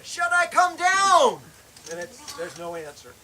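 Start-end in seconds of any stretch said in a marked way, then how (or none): 0.0-1.4 loud, slow call, much higher; yelling to a friend below the cliff; shouting down a cliff. Retold or imitated. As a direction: call down into the snowstorm. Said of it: "I'm shouting random things".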